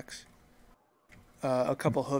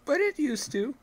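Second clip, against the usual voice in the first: odd farmer voice; 12 semitones higher, 5 dB louder than usual. Acted.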